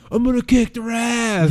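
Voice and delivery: lightly hammed-up promo threat; mock heated threat. Co-host imitating a wrestler's heated promo, mock threat. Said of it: "he's all like".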